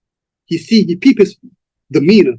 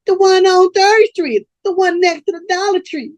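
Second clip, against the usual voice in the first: put-on girl answering Daddy. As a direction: draw it out up high as one of the girls. Girl answers pimp in story.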